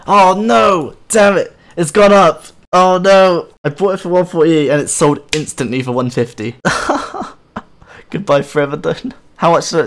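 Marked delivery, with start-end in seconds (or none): 0.1-3.5 loud, high "oh no" of real dismay; dismayed outcry. Real, not acted. Gamer to viewers as his sold bow's price jumps, loud dismayed cry. Price jumped after selling bow. 6.6-7.3 a high airy laugh at his own misfortune; high breathless laugh. Real, not acted. Gamer laughing at himself after selling too cheap, high airy laugh.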